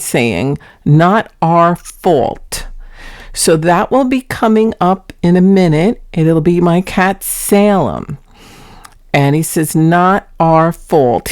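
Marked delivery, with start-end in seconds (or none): 0.8-2.7 slow, drawn-out quote of the cat; dragged-out words. Retold or imitated. Cat owner quoting her cat Salem, words dragged out. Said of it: "He tends to drag out his words". 9.8-11.3 slow, drawn-out quote of the cat; dragged-out words. Retold or imitated. Cat owner quoting her cat Salem, words dragged out. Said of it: "He tends to drag out his words".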